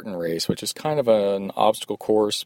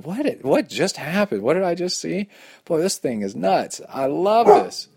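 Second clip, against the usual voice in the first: much higher, mock dazed wonder turning delighted; amazed; 9 semitones higher than usual. Racer running off after the aid station, giddy amazement. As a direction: babble in giddy disbelief. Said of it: "I remember running off going".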